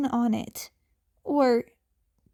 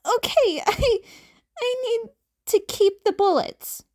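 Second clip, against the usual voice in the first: put-on higher, stammering teen voice; a flustered kid, pitched up; nervous teen; 9 semitones higher than usual. Izuku stalling in front of Eraserhead, higher and nervous.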